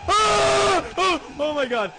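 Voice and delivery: loud, high frightened shriek; real jump-scare scream; startled scream. Real, not acted. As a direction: scream at the sudden monster. Startled by monster.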